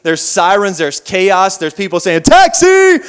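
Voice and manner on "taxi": high, loud shout for a cab; street taxi hail. Retold or imitated. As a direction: yell out like hailing a New York taxi. Imitating New Yorkers hailing taxis.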